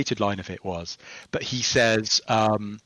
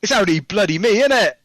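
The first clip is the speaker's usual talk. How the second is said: put-on higher, louder Cockney accent on the line; Cockney version; 9 semitones higher, 7 dB louder than usual. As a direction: say it like a cheeky Cockney lad. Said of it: "which was in Cockney".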